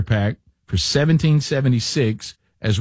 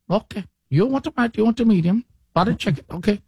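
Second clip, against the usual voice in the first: put-on Indian accent; 7 semitones higher than usual. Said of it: "very thick Indian accent".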